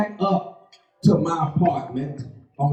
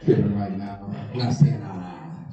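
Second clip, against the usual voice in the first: low, soft, sheepish phone voice; little put-on voice; 11 semitones lower, 4 dB quieter than usual. Caller downplays domestic fight to police, low and meek. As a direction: play it small and innocent to the police. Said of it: "put on a little voice".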